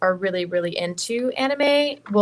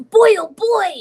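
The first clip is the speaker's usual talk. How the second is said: put-on much higher, louder boy voice; raspy little boy voice; 12 semitones higher, 9 dB louder than usual. Shown as a demonstration. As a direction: shout it like an excited kid. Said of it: "little boys are my favorite".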